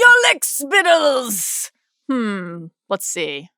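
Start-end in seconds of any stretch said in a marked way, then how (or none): 0.0-1.7 slow, high, sneering royal drawl; Viserys impression. Quoting Viserys to the co-host, slow high sneer. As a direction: sneer it out like a spoiled prince. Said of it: "Nobody said lick spittle quite like Viserys".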